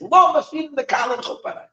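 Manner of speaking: high-pitched exultant call; retold yell. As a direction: call it out high, trailing off at the end. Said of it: "he yells".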